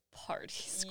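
guilty hushed whisper. Acted. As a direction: confess it in a whisper. Whispered admission after laugh.

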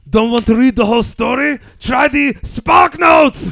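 high, shouted Austrian action-star villain voice; Arnold Schwarzenegger. Acted. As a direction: shout the pun, dragging out the punchline. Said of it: "if Arnold Schwarzenegger played Electro".

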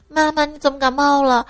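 lightly voiced little boy; young son asking. Son asks mum about her cold, lightly childlike high voice. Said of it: "儿子见我吃药就问".

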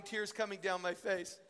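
normal talking voice

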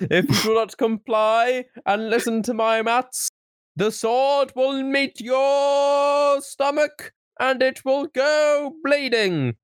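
high, very slow hammed-up villain threat; enraged boss roar. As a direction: drag out a cartoon boss's threat.

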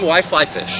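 ordinary delivery

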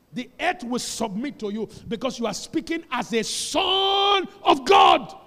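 shouted, slowed climax; preaching shout. Acted. Preacher declaring to believers, shouting. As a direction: shout it out to the church.

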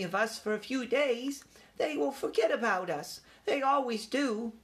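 high-pitched, assured youngster; young mouse taking charge; young mouse leader. A narrator's reading. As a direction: lay out the plan in a small, high voice. Young mouse lays out plan.

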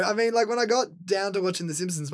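ordinary talk, nothing marked

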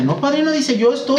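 squeaky high imitation of a cheerful young woman; imitating goddaughter. Goddaughter reassuring her godfather she's fine, squeaky high. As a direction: pitch it way up, girlish and sure of herself.

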